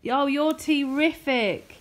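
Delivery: mother thanking her son for his card; delighted gushing. Real, not acted. Mother praises child's gift.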